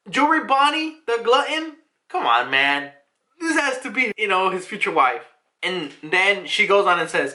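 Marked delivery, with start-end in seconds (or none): none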